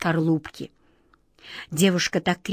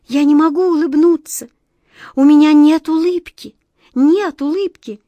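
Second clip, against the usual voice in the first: put-on little girl voice; 7 semitones higher, 8 dB louder than usual. A narrator's reading. Child insisting sadly.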